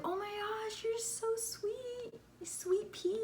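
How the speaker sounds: very high, soft, drawn-out coo; imitated sweet cooing. Imitates her sweet reply.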